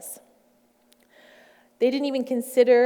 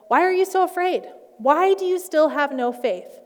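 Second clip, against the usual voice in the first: raised, high, emphatic questioning; stern, incredulous reproach, pitched up; exasperated rebuke; 9 semitones higher, 6 dB louder than usual. Acted. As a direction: rebuke them with voice lifted high and loud. Reading Jesus rebuking his disciples.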